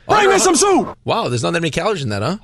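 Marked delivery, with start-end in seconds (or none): 0.1-1.0 loud, high shout for soup. Pretend. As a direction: yell the order across the room. Shouted demand.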